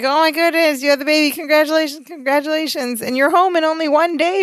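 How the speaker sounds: high, excited imitation of congratulations; mock gushing. Friends congratulating a couple home from hospital, gushing. Retold gushing over supposed new baby.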